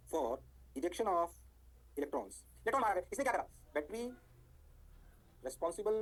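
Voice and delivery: slow, halting note dictation. Real, not acted. Teacher dictating what the battery does, slow dictation. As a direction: dictate in small chunks with long gaps.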